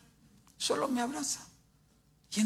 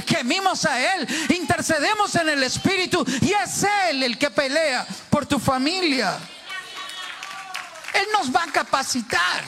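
raised, ringing sermon voice that builds to shouts; full-force preaching; 6 semitones higher, 4 dB louder than usual. Acted. Preacher exhorting the church, preaching at full force. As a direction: preach it out to the whole congregation.

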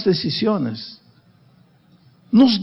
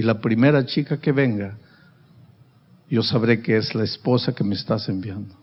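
earnest prayer; 7 semitones lower than usual. Acted. Young man's prayer to God.

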